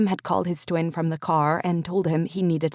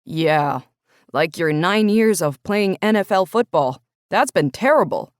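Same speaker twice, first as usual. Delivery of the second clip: sarcastic brother; 7 dB louder than usual. Acted. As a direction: tease him with heavy sarcasm. Brother mocks Cam's self-pity.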